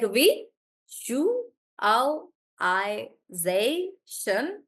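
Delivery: we, you, al, I, zay, shun broken into separate beats; syllable by syllable. Shown as a demonstration. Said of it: "so I'll do it like".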